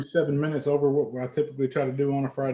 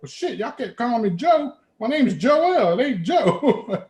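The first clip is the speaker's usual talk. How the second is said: slow, higher, drawn out, each word leaned on; insistent retort; 7 semitones higher, slower than usual. Retold or imitated. Arguing with a Chicago guy about his name, slow and insistent. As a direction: spell it out slowly, stubborn.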